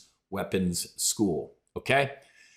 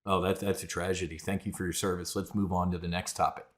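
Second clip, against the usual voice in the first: mock indifference; 5 semitones lower, 6 dB quieter, faster than usual.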